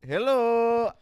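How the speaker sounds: high, slow mock Indian accent. Shown as a demonstration. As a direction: put on a hammy, drawn-out Indian accent. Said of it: "Sacha Baron Cohen dressed up as an Indian guy".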